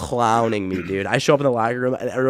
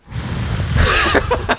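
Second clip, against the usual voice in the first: high breathless laugh; 5 dB louder than usual. Real, not acted. Coach's reaction laughed off.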